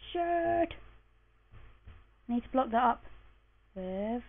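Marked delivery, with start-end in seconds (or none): none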